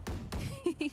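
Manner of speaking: evil cackle at the other team; villain laugh. Acted. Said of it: "don't laugh like that".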